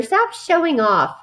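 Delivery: high, drawn-out scolding; crying out. A narrator's reading. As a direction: scold like an embarrassed mother. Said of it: "cried his mother".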